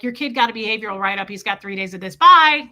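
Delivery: rattled off, ending in a loud bye; mock brush-off. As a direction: rush the notice, then blurt the sign-off. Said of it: "Don't just call me and be like".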